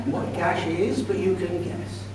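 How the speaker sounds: ordinary delivery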